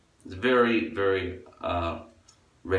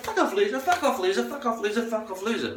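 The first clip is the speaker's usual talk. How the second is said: much higher, sneering singsong brush-off; mock girl sneer. Comic imitates a drunk girl rejecting guys, high sneering singsong. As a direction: squeal the rejection like a mean girl. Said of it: "She's like".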